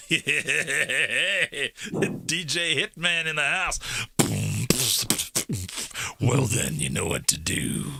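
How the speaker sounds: loud hammed-up DJ voice; radio DJ. Editor turning Hitman into a radio DJ, hammed-up and loud. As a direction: boom it out like a radio host. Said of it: "You're locked into Hitman Radio".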